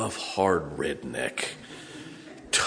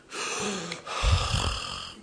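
imitated gasp; 10 semitones higher than usual. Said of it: "a minute later goes".

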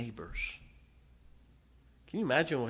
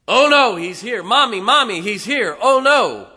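loud, high panicked shrieks; terrified scream; 11 semitones higher, 16 dB louder than usual. Acted. Little girl sees her father at the door, screaming loud and high in panic. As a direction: scream for Mommy in terror. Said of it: "she screams".

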